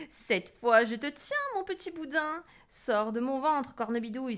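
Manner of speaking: forceful storybook exclamation; crying out. A narrator's reading. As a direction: challenge the witch out loud. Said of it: "s'écria Pierre".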